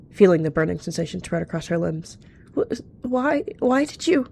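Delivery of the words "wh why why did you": high, stammering distressed plea; hurt and bewildered. A narrator's reading. Poisoned young cat to her attacker, frightened stammer. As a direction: struggle to get the question out.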